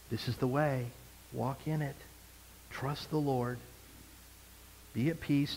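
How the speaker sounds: soft and gentle, barely above a breath; still, soft voice. Acted. Preacher voicing the Spirit to his congregation, soft and still. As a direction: speak softly as the Spirit reassuring the listener. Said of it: "in this beautiful, still, soft voice".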